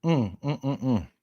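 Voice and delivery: soft appreciative hums over coffee; quiet contented mms after a sip; savoring hum. Real, not acted. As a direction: hum quietly as if tasting something good. Sipping coffee after the tribute.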